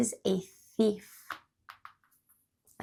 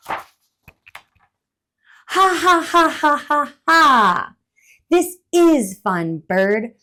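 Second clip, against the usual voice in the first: much louder, high spelled-out laugh; laughing squirrel; 4 semitones higher, 9 dB louder, slower than usual. Acted. Gleeful laughing squirrel. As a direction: squirrel laughs during snowball fight with bird.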